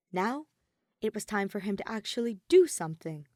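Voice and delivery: plain reading voice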